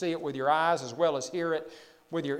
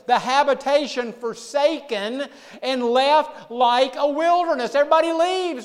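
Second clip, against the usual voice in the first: higher, louder preacher's reading; forceful scripture preaching; 8 semitones higher, 9 dB louder than usual. Real, not acted. Preacher reading verses on desolate wilderness, forceful.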